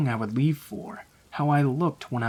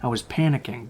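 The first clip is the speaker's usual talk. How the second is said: ordinary delivery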